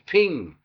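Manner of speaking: sharp loud "ping" for a punch; punch sound effect. Retold or imitated. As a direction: smack the word out like a blow landing. Imitating the punch landing.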